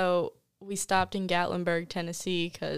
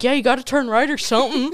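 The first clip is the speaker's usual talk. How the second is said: loud, higher-pitched bad Southern accent; 6 semitones higher, 10 dB louder than usual. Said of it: "this deep Southern redneck".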